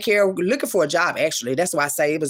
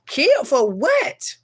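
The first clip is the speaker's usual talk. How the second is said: high, drawn-out rhetorical question; incredulous; 7 semitones higher, much slower than usual. Real, not acted. Denying wanting anybody killed, incredulous. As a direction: stretch it out in disbelief.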